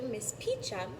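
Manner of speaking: usual speaking voice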